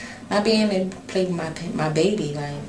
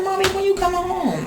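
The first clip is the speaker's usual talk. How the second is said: put-on much higher little girl's voice; imitating her daughter; 14 semitones higher than usual. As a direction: ask like a small child wanting mom home. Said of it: "She said".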